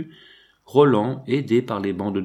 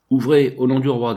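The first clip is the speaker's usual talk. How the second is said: louder, rough command at the door; 3 semitones higher, 6 dB louder than usual. Acted. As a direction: order the door open roughly. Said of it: "dit une voix rude". (voix rude et plus forte, ordre sec)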